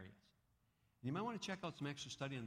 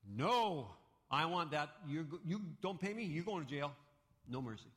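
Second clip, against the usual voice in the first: louder, angry refusal; harsh refusal; 5 semitones higher, 6 dB louder than usual. Acted. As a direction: refuse him with contempt. Creditor refuses neighbor's plea.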